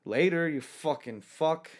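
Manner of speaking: slow high mocking goodbye; sneering farewell. Host to the ejected man, slow sneering goodbye. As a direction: sneer the send-off. Parting insult to the ejected guy.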